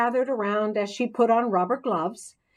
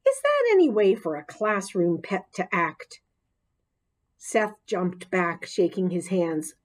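ordinary delivery, nothing marked

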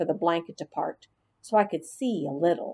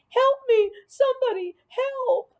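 much higher, louder panicked cry for help; screaming for help; 3 dB louder than usual. Acted. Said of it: "As Marty continued to scream".

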